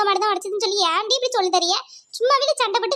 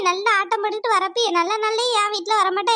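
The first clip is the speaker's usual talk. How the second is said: usual speaking voice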